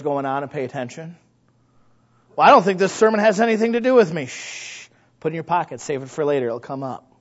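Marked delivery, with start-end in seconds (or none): 2.3-4.3 high, quick, louder protest; hammed-up dismissive churchgoer; mock objection. Acting out a dismissive listener. 4.3-4.9 long unvoiced hush; drawn-out "shh"; shushing. Hushing the imagined objector.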